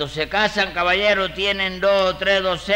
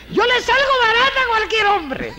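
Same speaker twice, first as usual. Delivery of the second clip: very high falsetto imitation; hammed-up squeal of a woman selling herself as thrifty; mock woman's voice; 4 dB louder than usual. Man mimics single women to the court in high falsetto. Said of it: "y ellas mismas te lo dicen".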